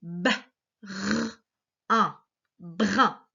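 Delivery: BRIN broken into B, R, UN, then blended; sounded out. Shown as a demonstration. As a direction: sound out B, R, UN, pause between each, then say BRIN. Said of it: "c'est la syllabe brin".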